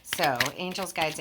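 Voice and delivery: ordinary talk, nothing marked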